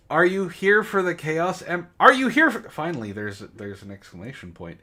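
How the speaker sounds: ordinary talk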